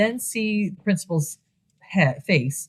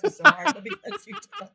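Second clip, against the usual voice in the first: laughing. Retold or imitated. A host retells a movie line while laughing higher. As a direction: giggle your way through the line.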